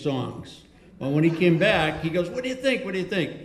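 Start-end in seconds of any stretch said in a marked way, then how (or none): 2.3-3.3 high-pitched, repeated eager question; retold eager asking. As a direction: imitate a singer fishing for praise. Retold singer eagerly asks opinion.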